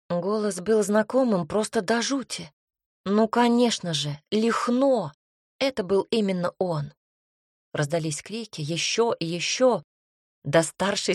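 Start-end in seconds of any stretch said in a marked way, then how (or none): none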